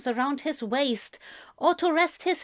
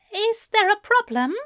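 high, anxious asking; nervous question; 11 semitones higher, 4 dB louder than usual. A narrator's reading. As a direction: ask it nervously, a bit higher. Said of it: "he asked nervously".

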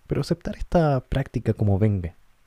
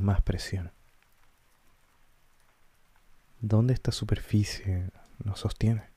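calm guided-meditation voice with long pauses. Acted. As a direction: guide listeners gently inward, leaving space between short phrases. Guía una meditación con pausas. (voz pausada de meditación guiada)

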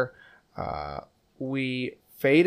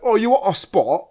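higher, louder imitation of a Cockney nanny; mock Cockney nanny; 8 semitones higher, 7 dB louder than usual. Retelling the nanny's offer for a co-host, in mock Cockney.

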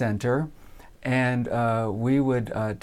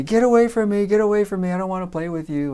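lightly voiced higher kids' complaint; a touch of sing-out childish annoyance, tailing off quicker; little girls protesting; 7 semitones higher, faster than usual. Girls telling a bothersome boy to go away, mildly annoyed.

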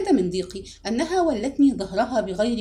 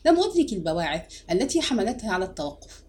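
usual speaking voice

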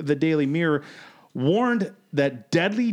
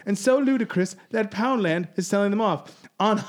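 put-on British accent; 5 semitones higher than usual. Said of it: "I gotta stop using this accent".

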